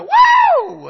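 loud, high "woo!"; joyful shout. Shown as a demonstration. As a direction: let joy bubble up into a whoop. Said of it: "a shout. Maybe just a".

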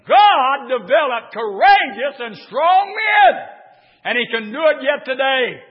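loud, high preacher's cry; full-throated revival preaching; preaching at full force. Acted. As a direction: build the congregation up, shouting.

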